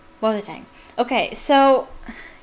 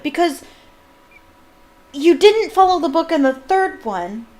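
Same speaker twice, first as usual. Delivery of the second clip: worked-up, high-pitched rant; real exasperation at an inconsistency; getting angry; 7 semitones higher, 4 dB louder than usual. Real, not acted. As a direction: argue it indignantly, pitch climbing. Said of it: "I'm getting angry".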